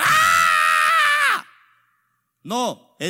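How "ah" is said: very high, loud, sustained "aah"; mock crowd scream. Shown as a demonstration. (grito de loco, agudo y sostenido)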